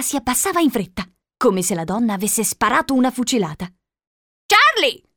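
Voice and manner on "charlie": put-on high, loud old-woman call; shouted call. A narrator's reading. Old lady calling Charlie out of the shack, shouting. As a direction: cry out the name sharply upward. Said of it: "gridò la vecchia signora".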